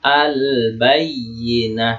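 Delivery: very slow "Al-Bayyinah", l sounded before b; clearly read lam. Shown as a demonstration. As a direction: stretch "Al-Bayyinah", holding the l and the yy. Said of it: "Dibaca ba-nya jelas ya".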